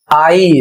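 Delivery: आई said as one clear complex sound; teacher models आई; model pronunciation. Shown as a demonstration. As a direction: demonstrate आई carefully. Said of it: "इस तरीके से".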